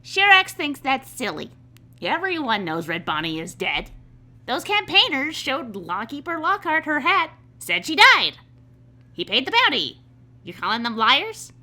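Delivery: loud, indignant lizardfolk woman; Shirax. Acted. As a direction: insist loudly in a scoffing character voice. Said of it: "And Shirax says".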